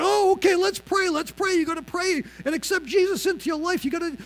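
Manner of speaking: high, loud, eager rush to pray; hammed-up excited urging. Sick friend urging him to pray, excitedly. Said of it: "But he was all excited".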